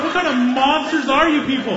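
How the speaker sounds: loud, high-pitched disbelief; appalled outburst. Retold or imitated. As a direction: exclaim it in comic outrage. Appalled reaction to dad's story.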